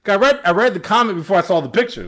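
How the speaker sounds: usual speaking voice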